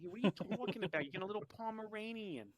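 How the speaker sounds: put-on high, loud mob-wife New York accent; mock New York-Jersey accent. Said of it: "they talk like all the people".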